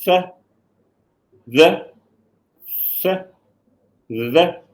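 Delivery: th with tongue tip between teeth; demonstrated th. Shown as a demonstration. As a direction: hold the th, air flowing out. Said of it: "turn to this side and do it".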